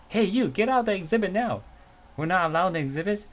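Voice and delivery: high, loud order to get out; loud, high command from a zoo guard to an intruder; guard yelling. Acted. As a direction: yell at the trespasser.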